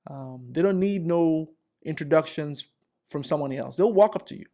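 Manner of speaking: ordinary talk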